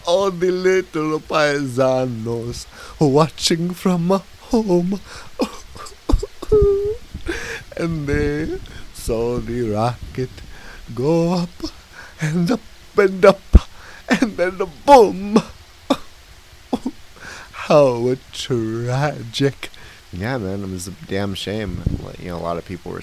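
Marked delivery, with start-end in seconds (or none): 0.1-15.4 slow, high, loud clown lament; Mister Panini. Acted. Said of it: "I was Mister Panini". 17.5-19.7 very slow, loud, theatrical grief; hammed-up tragic sigh; Mister Panini. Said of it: "I was Mister Panini".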